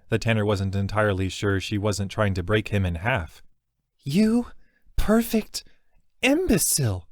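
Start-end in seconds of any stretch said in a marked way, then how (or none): 4.0-7.1 very high, tearful, pressed into cloth; muffled into shoulder. Acted. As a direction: cry it out into his shoulder. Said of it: "her muffled voice sounded into his shoulder".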